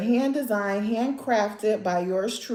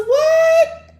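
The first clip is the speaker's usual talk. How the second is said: high, loud sung-out squeal; excited squeal; 10 dB louder than usual. Real, not acted. Vlogger reacting to her finished nails, squealing with joy. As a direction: belt it out in delighted disbelief.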